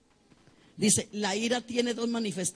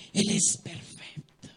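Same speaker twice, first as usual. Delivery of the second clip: slow, breathy near-whisper; slow whispered reverence; hushed awe; slower than usual. Acted. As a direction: let it fall to a reverent hush. Repeats line, first hushed.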